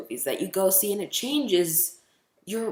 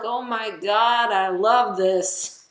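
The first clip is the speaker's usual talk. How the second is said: slow, loud, high swooning gush; gushing love; 7 semitones higher, 6 dB louder, much slower than usual. Retold or imitated. Fan describes watching E T, slow swooning gush. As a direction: swoon over it, drawn out. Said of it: "you're like".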